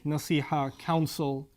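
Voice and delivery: ordinary delivery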